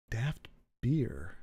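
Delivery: breathy whisper of the phrase. Shown as a demonstration. Whispering the phrase back to herself. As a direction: whisper it to yourself, savouring it.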